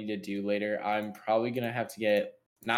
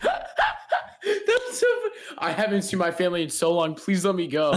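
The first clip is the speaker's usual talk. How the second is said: laughing plea; 8 semitones higher, slower than usual. Pretend. Begging to go home, laughing.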